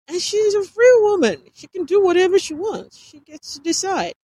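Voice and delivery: high and loud, shouting she is free; defiant outburst. Acted. Dylan insists defiantly.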